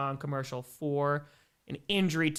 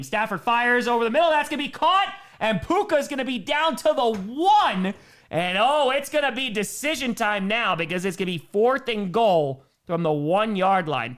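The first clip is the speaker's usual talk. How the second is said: loud, raised-pitch football call; live play-by-play; 7 semitones higher, 6 dB louder than usual. Real, not acted. Announcer calls a Rams goal-line play for viewers, excited play-by-play.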